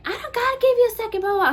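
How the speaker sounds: high, loud, rapid retort; mock yelling. Customer refusing to wait for the manager, high mock yelling. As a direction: imitate an angry customer talking over you. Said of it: "So I just left her yelling".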